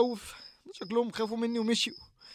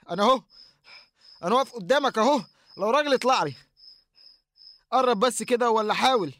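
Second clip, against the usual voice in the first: loud, higher challenge, bravado covering fear; defiant shout; 3 semitones higher, 5 dB louder than usual. Acted. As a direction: shout him down to seem brave. Challenging the figure again. (تحدي بصوت عالي يخفي الخوف)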